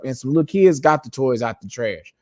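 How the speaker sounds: usual speaking voice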